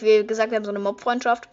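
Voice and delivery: normal talking voice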